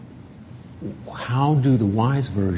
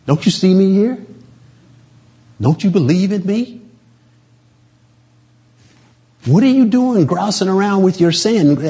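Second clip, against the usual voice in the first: higher, louder mock scolding from God; God scolding, mildly angry; a little angry; 9 semitones higher, 3 dB louder than usual. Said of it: "God might just get a little angry".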